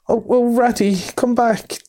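high, pleading Mole calling after Ratty; Mole crying out. A narrator's reading. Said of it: "cried the Mole".

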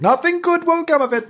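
high-pitched cautionary wail; mock moral warning. Storyteller draws a moral from the tale, mock warning. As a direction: warn them in a silly high voice.

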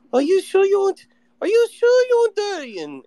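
high-pitched imitation of a heavy Chinese accent; mock a waitress with a thick Chinese accent, pitched high. Waitress doubting a customer's durian order, high mock Chinese accent. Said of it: "she dropped the accent".

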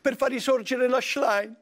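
high-voiced politician caricature; Giorgia Meloni. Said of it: "Presidente Meloni".

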